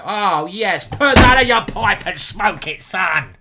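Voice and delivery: loud victorious taunt; gloating shout. Real, not acted. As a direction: rub it in his face. Gloating over opponent.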